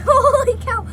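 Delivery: loud shaky cry of fright; thrilled scream. Real, not acted. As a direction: yell out half laughing, half scared. Real scare on a tube ride.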